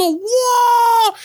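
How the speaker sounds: high shouted crowd cry; crowd roar. Retold or imitated. Rapper imitating the crowd's roar. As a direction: roar like a packed hall.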